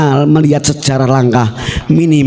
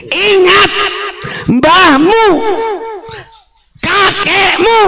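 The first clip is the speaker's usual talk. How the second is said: very high, drawn-out rebuke; fiery preacher scolding an opponent; shouted admonition; slower than usual. Real, not acted. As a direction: call out each word on its own, high and forceful. Admonishing opponent before crowd.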